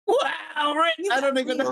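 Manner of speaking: high-pitched cartoon creature voice; Mister Meeseeks. Guest redoing their accent video, high cartoon voice. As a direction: put on a high, silly cartoon voice. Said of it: "I can do a good Mister Meeseeks".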